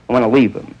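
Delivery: ordinary talk, nothing marked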